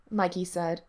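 usual speaking voice

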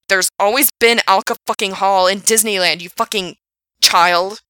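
loud furious shout. Acted. Frank shouting at Gerard about Disneyland, furious shout. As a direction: explode at him. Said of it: "he shouted".

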